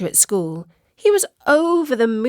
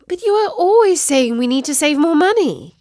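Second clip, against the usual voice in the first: higher, louder indignant protest; 8 semitones higher, 6 dB louder than usual. Acted. Katie protesting to her mum about money, indignant. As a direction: push back hard at mum. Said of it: "Katie protested".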